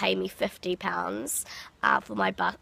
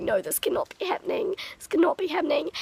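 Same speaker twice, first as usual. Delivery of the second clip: higher, partly whispered mock panic; retold freak-out; 8 semitones higher, faster than usual. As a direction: replay the disbelieving panic in a thin voice. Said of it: "I just freaked out".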